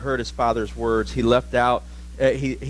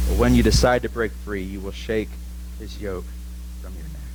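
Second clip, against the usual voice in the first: solemn prophecy; 5 semitones lower than usual. Acted. Isaac pronouncing his son's future, low and solemn. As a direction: lay down his fate gravely.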